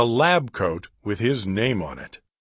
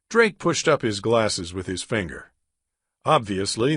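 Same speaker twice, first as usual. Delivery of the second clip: ordinary delivery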